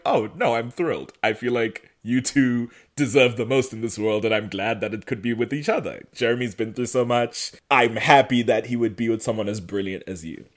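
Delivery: low, soft, sincere reassurance; Elena's tender blessing. Retold or imitated. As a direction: give a gentle, heartfelt blessing. Elena gives Bonnie her blessing.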